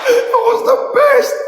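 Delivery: high, delighted, half-laughing. Real, not acted. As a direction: gush with laughter. Delight after daughter's cheer.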